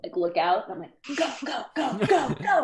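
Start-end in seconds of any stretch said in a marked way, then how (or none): 1.2-2.6 high, quick sneaking-out urgency; urgent hushed urging. Acted. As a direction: usher friends through in a high, urgent voice. Sneaking friends out through the fence.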